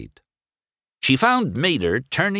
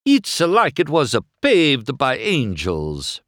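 put-on higher, Italian-accented wonder; Italian-accented awe; 7 semitones higher, 3 dB louder than usual. A narrator's reading. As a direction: marvel in a thick Italian accent. Luigi's Italian voice continues.

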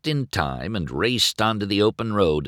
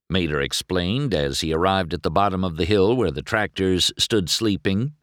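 usual speaking voice

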